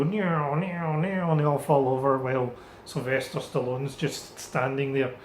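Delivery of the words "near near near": whining game-character death noise; imitated falling guys. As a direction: mimic little figures keeling over. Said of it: "typical way that all the little guys go".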